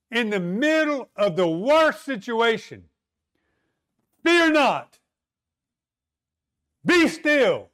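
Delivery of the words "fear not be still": commanding. Retold or imitated. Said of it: "God says".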